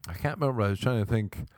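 usual speaking voice

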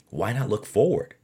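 ordinary delivery

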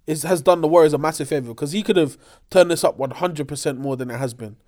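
ordinary talk, nothing marked

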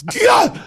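high, explosive mock tennis grunt; tennis grunt imitation. Host mocking women's tennis, mock grunt. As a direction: yelp like a player hitting the ball.

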